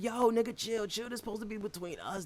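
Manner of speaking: high, hushed plea; pleading whisper-shout. Retold or imitated. [name] begging Josh to keep the drawing secret, high hush. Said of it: "I'm like".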